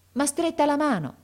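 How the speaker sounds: high, loud burst of hope; excited exclamation. A narrator's reading. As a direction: cry out in sudden amazement. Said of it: "esclamò". (esclamazione alta ed eccitata)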